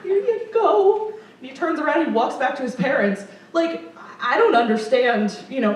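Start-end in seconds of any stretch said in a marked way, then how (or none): 0.0-1.1 high, slow, sugary here you go; sweet to a child. Retold or imitated. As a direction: coax a shy kid, gently. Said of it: "and I'm like".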